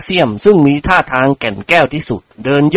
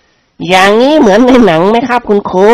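higher, slow, mocking menace; mock-menacing; 6 semitones higher than usual. A narrator's reading. Thug student waving a flick knife at teacher, slow mocking menace. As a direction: wave the knife and taunt.

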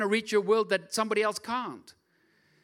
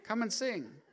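soft invitation; 3 semitones higher, 7 dB quieter than usual. Real, not acted. Repeats the gentle invitation.

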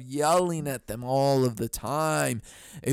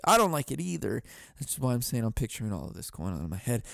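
normal talking voice

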